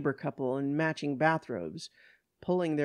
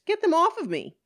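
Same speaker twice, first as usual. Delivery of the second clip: much higher, loud panicked cry; panicked demand; 14 semitones higher, 7 dB louder, faster than usual. Acted. Blaine to police as girls cling to her, panicked demand. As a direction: cry out desperately to be rid of them.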